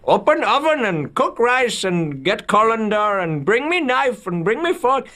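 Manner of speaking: very high-pitched mock scream of orders; high, insistent orders in a hammed-up voice. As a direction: imitate a frantic cook screaming a string of commands. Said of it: "B K screaming at him, like, screaming orders".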